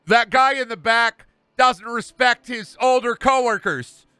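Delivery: high-pitched, hammed-up indignant rant; mock pissed-off wrestler. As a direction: play an aggrieved wrestler demanding respect. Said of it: "I'm fucking pissed about it".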